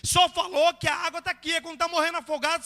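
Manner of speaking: loud, high pulpit exhortation; full-force preaching. Real, not acted. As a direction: drive the point home forcefully.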